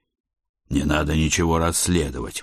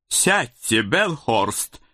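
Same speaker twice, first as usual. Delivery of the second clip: commanding committee lady; 5 semitones higher, much slower than usual. A narrator's reading. Grey-haired lady orders officer to sit.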